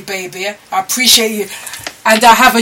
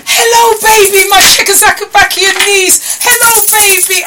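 high, loud hello to the niece; excited greeting; 9 semitones higher, 4 dB louder than usual. Real, not acted. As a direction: call out to her with delight. Calling out to her niece.

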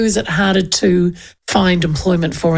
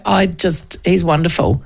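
ordinary talk, nothing marked

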